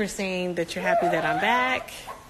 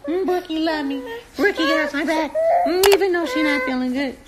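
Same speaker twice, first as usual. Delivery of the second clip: loving coo; 7 semitones higher, 6 dB louder than usual. Real, not acted. Cooing lovingly at baby.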